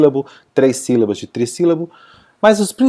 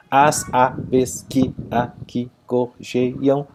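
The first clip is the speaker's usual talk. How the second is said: slow, separate beats on "As aves que aqui gorjeiam"; syllable by syllable; 5 dB quieter, much slower than usual. Shown as a demonstration. Teacher counting grammatical syllables, "As aves que aqui gorjeiam" syllable by syllable. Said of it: "Separando em sílabas gramaticais".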